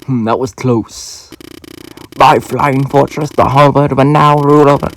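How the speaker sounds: soft, hushed attempt at a Spanish accent; quiet, self-confessed bad Spanish accent for Meta Knight; a knight gloating in an amateur Spanish accent. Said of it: "that was my Meta Knight voice".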